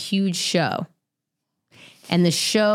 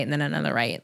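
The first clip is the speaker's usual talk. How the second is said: softer, lower; 7 semitones lower, 5 dB quieter, faster than usual. Pretend.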